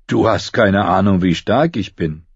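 plain-voiced boy showing off his strength; boy boasting. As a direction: brag evenly, without raising the pitch. Bull's boast, set against Arya's.

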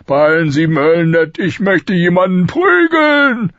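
high, loud royal rant; roaring King Robert, exasperated. A narrator's reading. King demanding his pleasures from Ned, exasperated rant. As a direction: rant with swelling bluster to the last word.

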